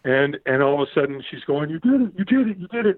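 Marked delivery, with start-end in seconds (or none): 1.7-3.0 high-pitched imitated congratulation; excited chant, pitched well up; retold cheering. Girl pulling him off the coals, high excited cheering.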